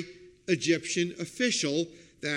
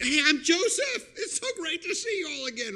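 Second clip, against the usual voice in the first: high-pitched, gushing reunion greeting; mock joyful reveal; 12 semitones higher than usual. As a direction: burst out with exaggerated delight. Imagined joyful reveal to brothers.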